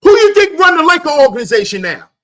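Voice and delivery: high, loud, rapid-fire demand; real shout, high and fast; shouted question. Real, not acted. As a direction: fire the question out at full volume. Ranting loudly at his viewers.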